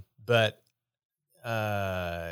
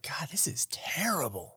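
mock whisper; 4 semitones higher, 9 dB quieter than usual. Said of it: "I'm like".

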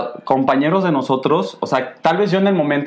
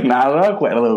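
laughing. Real, not acted. Laughs at his own joke.

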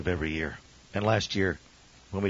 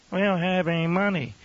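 lightly mimicked excuse, pitched up; mock objector; 7 semitones higher than usual. Church member excusing themselves from missions, lightly mocked. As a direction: imitate someone pleading poverty.

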